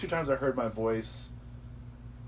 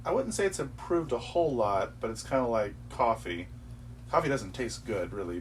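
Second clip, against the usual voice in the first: ordinary talk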